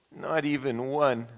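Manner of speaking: mild mournful sigh of a line; understated sad Toad; sadly. Acted. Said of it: "said Toad sadly".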